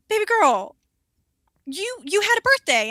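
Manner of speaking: high, loud camp voice; camp client voice. Retold or imitated. Stripper client greeting his waxer: high camp gushing. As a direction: gush like a flamboyant client. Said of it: "he was like".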